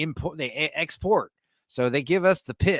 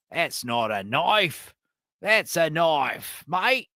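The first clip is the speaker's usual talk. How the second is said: slow, drawn-out Aussie movie quote; Australian accent impression; 2 semitones higher, much slower than usual. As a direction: stretch it out in broad Aussie. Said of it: "the Australian accent".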